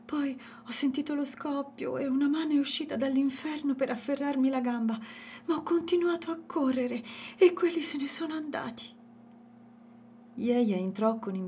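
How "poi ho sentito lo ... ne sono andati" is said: high, panting, falling to a frightened whisper; breathless fear. A narrator's reading. Dalia telling her husband of the attack, panting and scared. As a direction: gasp it out, still shaken. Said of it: "ansimò Dalia".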